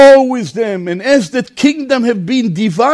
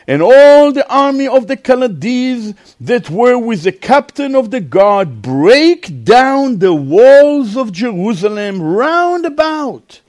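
scripture reading; 3 semitones higher than usual. A narrator's reading. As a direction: draw the verses out for a congregation.

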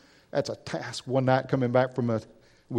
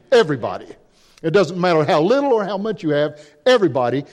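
higher, forceful preacher's delivery; emphatic preaching; 5 semitones higher, 5 dB louder than usual. Acted. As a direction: drive it home to the congregation, louder and higher.

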